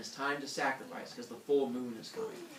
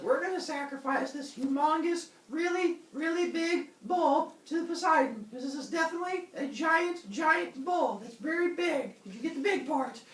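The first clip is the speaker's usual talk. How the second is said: high, strained mock proclamation; hammed-up announcement, pitched way up; overselling king. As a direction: lay it on thick to sell the lie. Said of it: "keep talking about how big".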